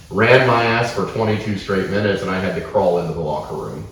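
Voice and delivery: plain reading voice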